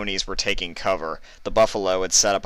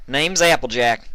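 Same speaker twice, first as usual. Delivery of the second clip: loud, high country call; loud over gunfire; 7 semitones higher, 6 dB louder than usual. A narrator's reading. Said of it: "she said loudly over the gunfire".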